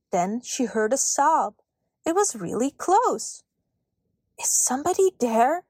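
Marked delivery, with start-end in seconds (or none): 4.4-5.6 mildly raised, questioning call. A narrator's reading. Girl asking who is hiding nearby, calling out. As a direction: call gently to see who's there. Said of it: "she called".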